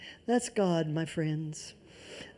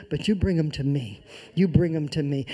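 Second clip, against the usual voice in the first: voice of the Lord; 6 semitones lower than usual. Acted. Preacher voicing the Lord to proud believers, low and gentle. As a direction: speak as the Lord, low and calm. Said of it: "does the voice of the Lord say".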